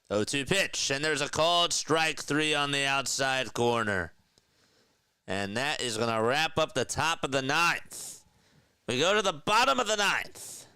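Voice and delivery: high, driving sportscaster call; announcer energy, pitch lifted throughout; live play-by-play. Real, not acted. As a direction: call the strikeout and wrap the inning.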